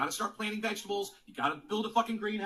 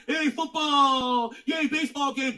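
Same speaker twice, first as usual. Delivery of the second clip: higher, louder sarcastic yay; mock cheer; 6 semitones higher, 8 dB louder, slower than usual. Ranting streamer mocks sports fans with a loud fake cheer. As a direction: ham up a dumb fan cheering.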